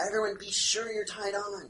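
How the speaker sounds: yelling up to deck. Acted. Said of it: "I yell up to on deck".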